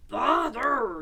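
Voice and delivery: loud, exasperated outburst; Pooh stuck. A narrator's reading. Pooh with his head stuck in a jar, loud exasperation. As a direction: call it out in frustration. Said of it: "he said inside the jar".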